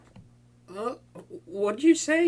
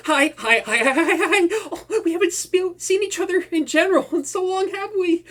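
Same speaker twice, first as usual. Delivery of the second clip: higher, giggly string of hi's; giddy reunion; 8 semitones higher than usual. Acted. Villain greeting his old friend, giggly high excitement. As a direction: bubble over at seeing her.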